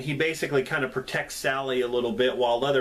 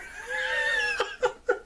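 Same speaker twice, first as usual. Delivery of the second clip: very high, wild mock cackle of the old cook; cackling laugh. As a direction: let out a crazed, shrieking cackle. The cook cackles after urging.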